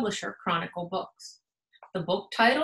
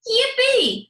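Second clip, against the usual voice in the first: high, loud yippee; bursting with birthday glee; excited cheer; 13 semitones higher, 8 dB louder than usual. Acted. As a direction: squeal it with joy.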